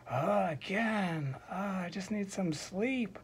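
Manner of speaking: high, drawn-out weary whine; exasperated troll. Acted. Troll woken a third time.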